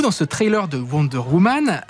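normal talking voice

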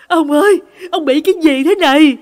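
high, loud cry of alarm; panicked shout. A narrator's reading. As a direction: shout in panic to a husband in trouble. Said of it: "thì bà hốt hoảng hét lên". (hét lên hốt hoảng, giọng cao và to)

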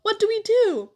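put-on high, loud, eager child's question; keen little-brother plea; pleading. A narrator's reading. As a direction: ask it eagerly, pitch way up. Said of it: "he pleaded".

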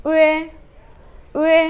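উয়ে said clearly on its own; Chinese final üe. Shown as a demonstration. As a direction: model উয়ে. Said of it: "উটা কীভাবে আসে দেখেন".

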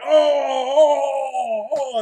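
high, loud pained groans; mock pained moaning. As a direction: moan like a monster down on its knee. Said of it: "he's like".

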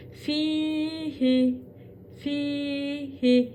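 Fihi with fi stretched, hi short; long fi. Shown as a demonstration. Teacher showing which syllable to stretch. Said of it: "hi'yi uzatmıyor".

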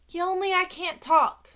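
put-on high, loud childish protest; insistent child. A narrator's reading. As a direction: refuse firmly, pitched high and loud. Child refuses to tell principal.

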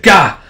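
loud, high burst of pain; acted grunt of pain. Wolf Queen bitten in battle, loud pained cry. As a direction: let out a sharp pained yell.

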